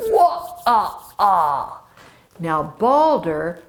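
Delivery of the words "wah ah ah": slow drawn-out villain laugh; a gloating trickster's laugh, slowly; hammed-up slow cackle; slow evil laugh. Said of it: "he laughed".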